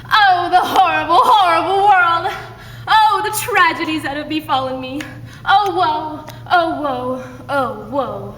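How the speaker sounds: mock melodrama lament; hammed-up tragic heroine. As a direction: overplay the tragedy for laughs. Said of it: "the harrowing expression as she sets the scene".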